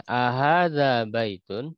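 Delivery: "Ahadza baitun?" read slowly with a rising question tune. Shown as a demonstration. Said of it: "nadanya nada pertanyaan ya".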